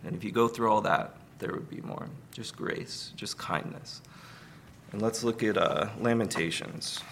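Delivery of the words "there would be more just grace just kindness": hushed. Real, not acted. Tender close of grace point.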